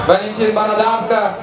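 ordinary delivery

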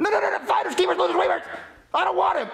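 high-pitched, frantic refusal; panicked protest. Acted. Reacting to unwelcome news, panicked protest.

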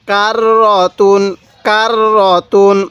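"কার র তুন" slowly, with a long held r; slow teaching recitation of "কার র তুন"; doubled r. Shown as a demonstration. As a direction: hold the r joining "কার" to "র", then "তুন". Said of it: "এই রটা আমরা দুইবার উচ্চারণ করব".

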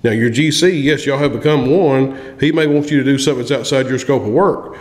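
ordinary delivery

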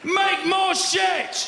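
very high, very slow mock Chinese shout; hammed-up Chinese boss yelling; factory boss shout. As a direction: yell the order slowly in a high mock Chinese accent.

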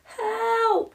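high, loud desperate yell; cry for help. A narrator's reading. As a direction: shout for help in terror. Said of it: "cried O'Parsley".

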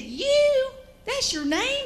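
a father stunned by a name he never knew, pitch leaping up; shocked disbelief. Retold or imitated. Daddy shocked at real name.

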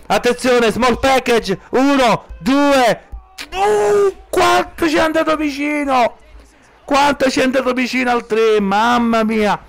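rising into an excited shout; breathless italian goal commentary. Real, not acted. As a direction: yell the near count breathlessly. Near-fall in wrestling commentary.

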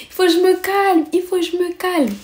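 high-pitched self-calming. Acted. Angry girl telling herself to cool off, high-pitched self-soothing. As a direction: sing out high, as if holding anger in.

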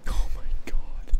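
breathless awed whisper. Real, not acted. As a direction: gasp it out in shock. Awed at the destruction.

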